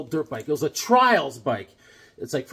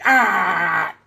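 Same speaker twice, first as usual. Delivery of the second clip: pained yelp; 2 semitones higher, 7 dB louder than usual. Retold or imitated. Crashed kid with a scraped back, crying out in pain. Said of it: "I was like".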